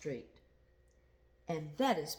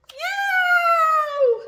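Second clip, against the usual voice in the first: very high, loud pained meow; cat yowling as Pine steps on its foot; 15 dB louder than usual. Acted. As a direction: screech like a cat with a trodden foot. Cat's foot stepped on.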